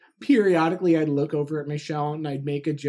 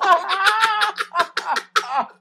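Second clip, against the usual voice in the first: long high laugh; 13 semitones higher than usual. Real, not acted. Laughing fit at own joke.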